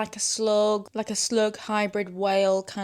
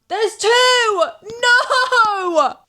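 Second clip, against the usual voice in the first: very high, loud cry; real shriek; dismayed shriek; 14 semitones higher, 12 dB louder, much slower than usual. Real, not acted. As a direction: despair at two flies. Second fly appears.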